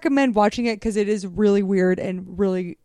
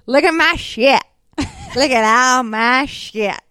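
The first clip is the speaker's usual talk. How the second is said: Alien from Spring Breakers, lips up; lightly done gangster drawl; 2 semitones higher, much slower than usual. Retold or imitated. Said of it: "he says shit like".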